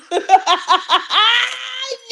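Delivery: long, high burst of laughter; real cackle. Real, not acted. Cracks up at her own bit.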